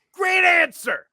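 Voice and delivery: high, loud shouted cheer; yell. Retold or imitated. Audience member backing a contestant's answer, high yell. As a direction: yell your approval from the audience. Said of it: "And I yell".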